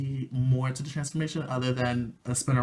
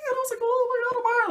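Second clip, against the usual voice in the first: much higher, louder fangirl gush; Sailor Moon voice squeal; 4 dB louder, much faster than usual. Retold or imitated. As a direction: squeal her name like a smitten heroine. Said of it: "screaming in my Sailor Moon voice".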